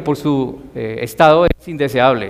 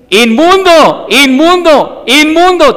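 much higher, louder, slow drawn-out wail of inmundo; leper's warning cry; 12 semitones higher, 8 dB louder, slower than usual. Retold or imitated. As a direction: cry out like a siren to warn people away. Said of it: "ellos tenían que gritar".

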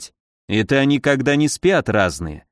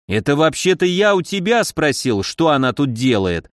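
usual speaking voice

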